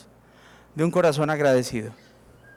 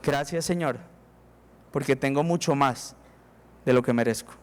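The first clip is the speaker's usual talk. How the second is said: soft, lower grateful prayer; humble prayer; 5 semitones lower, 3 dB quieter than usual. Pretend. Humble heart thanks God, soft and low. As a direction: say it quietly as a confession. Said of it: "le dice a Dios".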